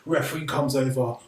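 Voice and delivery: usual speaking voice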